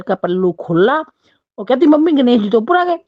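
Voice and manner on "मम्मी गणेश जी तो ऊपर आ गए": higher, louder, thrilled call to mother-in-law; excited discovery. Retold or imitated. Daughter-in-law tells mother-in-law the idol appeared, excited and higher. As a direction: announce the find with delight.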